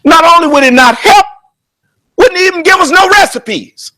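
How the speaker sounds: ordinary talk, nothing marked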